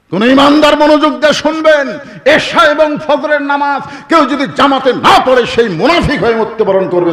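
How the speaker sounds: loud, high fiery admonition; full-force preaching. Real, not acted. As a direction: thunder the rebuke out over the congregation. (গলা ফাটিয়ে জ্বালাময়ী ওয়াজ)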